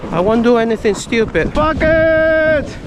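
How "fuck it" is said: reckless excited yell. Real, not acted. Deciding to jump on sled.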